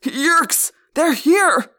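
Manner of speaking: frantic high, loud scream; freed man screaming a warning. Acted. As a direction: raise the alarm at the top of your lungs. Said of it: "the human screamed".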